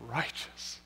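hushed, breathy whisper of one word; soft whispered verdict; grace speaking. Retold or imitated. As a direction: whisper it like a blessing. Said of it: "grace is looking at you and saying".

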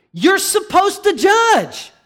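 loud, high, slow preacher's shout; forceful rebuttal. Real, not acted. Preacher corrects the congregation's excuse, emphatic shout. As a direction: hammer it out, high and drawn out.

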